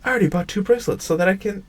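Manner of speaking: high, fast panicky denial; panicked denial. Acted. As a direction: refuse to believe it, rushing. Girl realizes she was scammed.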